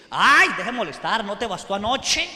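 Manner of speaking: loud, annoyed brush-off; irritated wife. Retold or imitated. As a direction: push the husband away, fed up. Carnal wife snaps at husband.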